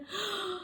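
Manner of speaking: sharp gasp of delight; high amazed gasp. Acted. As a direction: gasp in wonder. Man amazed.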